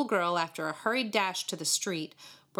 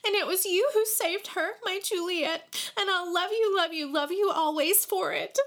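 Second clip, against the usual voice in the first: put-on grateful little girl; 12 semitones higher than usual. A narrator's reading. Girl thanks David for saving her kitten, high and gushing. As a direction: pour out thanks like an emotional child.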